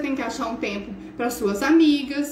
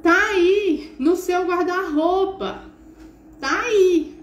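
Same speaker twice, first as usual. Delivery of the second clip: louder, higher call across the house; mum yelling back; 5 semitones higher, 3 dB louder, slower than usual. Retold or imitated. Mother yelling to her son where the shorts are, impatient and loud. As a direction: holler it from the kitchen, impatient. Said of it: "Aí você grita assim".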